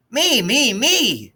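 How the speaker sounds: clamor to be picked; small boys begging to join the race; eager yelling. Acted. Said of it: "everyone yelled".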